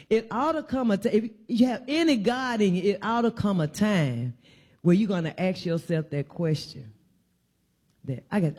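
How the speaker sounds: ordinary delivery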